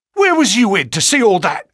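frightened cry. A narrator's reading. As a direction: blurt it out in sudden fright. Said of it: "he cried".

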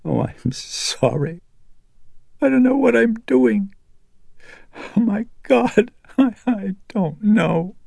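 mother collapsing into sobs after her outburst; sobbing. Acted. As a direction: fall apart in tears mid-sentence. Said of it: "convulsively sobbing into trembling hands".

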